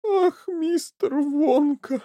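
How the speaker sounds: slow, high tearful moan; sobbing. A narrator's reading. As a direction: wail the name pleadingly. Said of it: "снова разрыдалась миссис Ти Ви".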